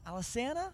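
"Alesana" as al-uh-SAN-uh, high and questioning; trying a pronunciation. Shown as a demonstration. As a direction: ask "Alesana" with a short flat a. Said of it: "I don't even know what it is".

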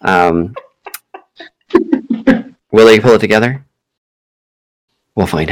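hammed-up announcer voice building suspense; dramatic show teaser. Host teasing listeners about the feud, announcer style. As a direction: tease the feud like a cliffhanger promo.

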